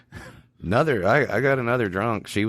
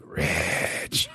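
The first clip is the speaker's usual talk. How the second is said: grudging whisper; 3 semitones lower, 4 dB quieter than usual. Retold or imitated. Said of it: "you know, like".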